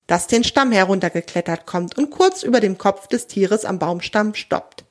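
ordinary delivery, nothing marked

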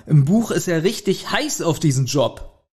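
ordinary talk, nothing marked

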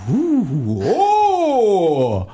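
ghost wail. Acted. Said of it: "ich fliege dich jetzt an".

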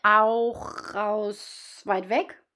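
very slow, drawn-out storyteller voice; fairy-tale far away. Pretend. Joking to her co-host about a faraway land, slow. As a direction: stretch it like a fairy-tale opening.

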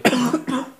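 sudden coughing fit from swallowing wrong; choking cough. Real, not acted. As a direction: cough hard after choking on a drink. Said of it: "ich habe mich verschluckt". (heftiges Husten nach dem Verschlucken)

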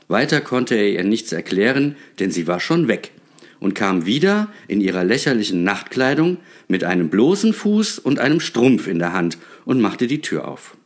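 plain reading voice

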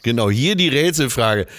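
normal talking voice

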